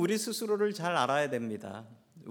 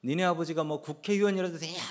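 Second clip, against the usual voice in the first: raised, higher-pitched mock outburst; flustered retold shout; 4 semitones higher than usual. Training camp commander yelling at a cheeky recruit, flustered shout. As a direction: act out a rattled officer yelling back. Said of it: "라고 소리를 쳤습니다".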